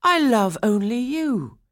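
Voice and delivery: high, slow, loud declaration; pleading cry. A narrator's reading. Franz declaring his love to Swanhilda, crying out. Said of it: "Franz cried".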